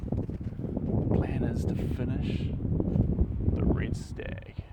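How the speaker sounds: a slow secretive whisper. Real, not acted. Painter whispering the plan to viewers, slow and hushed. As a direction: whisper it slowly, savouring each word.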